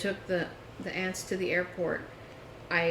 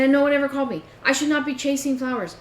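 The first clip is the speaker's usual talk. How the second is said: higher, louder, exasperated scolding; angry complaint; 6 semitones higher, 7 dB louder than usual. Retold or imitated. Retelling her complaint to him, higher louder angry scolding. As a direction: lay into him, you had one job.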